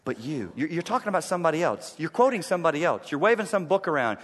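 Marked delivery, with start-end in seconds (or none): 0.0-0.5 low, soft, searching question; hushed solemn question. Acted. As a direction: ask it quietly, eye to eye. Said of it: "and would say".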